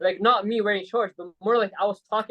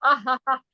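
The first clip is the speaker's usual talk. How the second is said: higher mock laugh, spaced ha's; imitated laugh; 4 semitones higher than usual. Answering friend's rebuke as a joke, higher imitated laugh. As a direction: fake a laugh at someone. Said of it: "And I was like".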